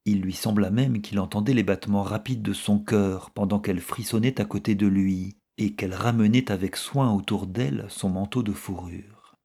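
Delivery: ordinary delivery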